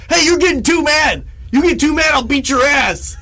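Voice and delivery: high, loud mock threat; bully threat. Bully threatens the narrator, loud mock threat. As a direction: bark it like a tough kid. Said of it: "And he's like".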